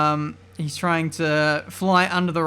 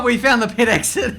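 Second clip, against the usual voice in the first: words pushed out through a laugh, higher and louder; 5 semitones higher, 6 dB louder than usual. Real, not acted. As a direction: laugh while saying it. Laughs at going into pits.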